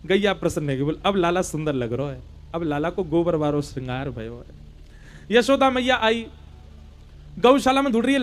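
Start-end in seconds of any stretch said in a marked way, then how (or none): 1.1-5.4 a lightly lowered, slowed cow voice; pleased cow. Acted. Pleased cow speaks to Krishna.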